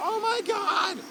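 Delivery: delighted squeal. Real, not acted. Rider adoring the little animals, high soft squeal. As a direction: gush high over something cute.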